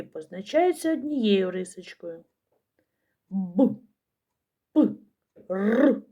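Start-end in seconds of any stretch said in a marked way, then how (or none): 3.3-6.0 Б, П, Р as hard, isolated sounds; hard consonants. Shown as a demonstration. Teacher shows children hard, grumbly consonants Б, П, Р. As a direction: sound out Б, П and a rolled Р, without softening. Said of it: "Тверді голосні, сердиті, буркотливі".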